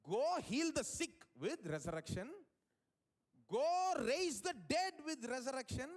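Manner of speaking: slow, stretched, rising proclamation; big, drawn-out heroic order; grand command. Acted. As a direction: boom it out like a mighty commission. Grand command he had expected from God.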